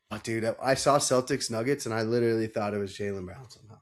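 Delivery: ordinary delivery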